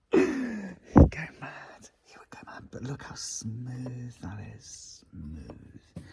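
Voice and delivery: slow whispered glee; whispered aside. Real, not acted. Joking that Dirty Garage Guy would be furious, slow gleeful whisper. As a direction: whisper it like a naughty secret.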